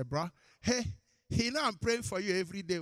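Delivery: high, loud mock announcement; hammed-up, quick-fire boast to a brother. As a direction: call it out high and loud, showing off your praying. Mocking boastful intercessor's announcement.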